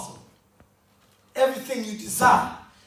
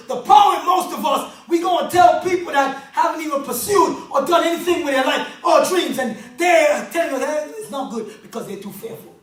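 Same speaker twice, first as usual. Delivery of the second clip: louder, higher preaching over the music; full-force preaching; 4 semitones higher, 5 dB louder than usual. Acted. Speaker at a conference rallying the crowd, shouted preaching.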